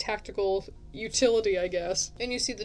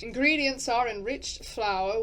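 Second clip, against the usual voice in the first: slow, generic English narrator reading; measured English-accented narrator; vaguely English narrator accent; 2 semitones higher than usual. Shown as a demonstration. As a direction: practise an English-ish accent, reading a label slowly. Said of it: "I've kind of gotten it back".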